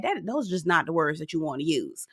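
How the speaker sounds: a hushed confiding aside; whispered aside. Real, not acted. Host warning viewers about 'calm down', whispered. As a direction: whisper it under your breath.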